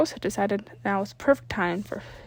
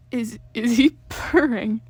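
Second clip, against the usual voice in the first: thrown; 5 dB louder, slower than usual. Acted. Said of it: "Tucker asked, a bit thrown back".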